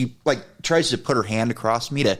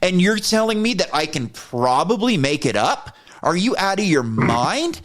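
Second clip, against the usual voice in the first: higher, exasperated retold rant; indignant rant; 6 semitones higher than usual. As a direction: throw her words back at her. Mocking her words angrily.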